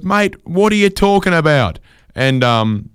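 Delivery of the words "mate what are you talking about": confused wife. Retold or imitated. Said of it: "And she goes".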